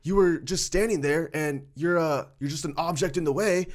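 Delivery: mock indignant accusation; pissed-off customer. Imitating the customer who blames him for standing there, pissed off. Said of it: "This guy was just pissed".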